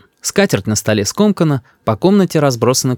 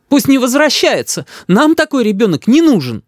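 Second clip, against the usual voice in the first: high-pitched angry mother; high, exasperated outburst; indignant mother; 12 semitones higher than usual. A narrator's reading. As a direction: disown him in a huff. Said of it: "говорит мама".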